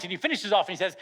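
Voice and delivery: ordinary talk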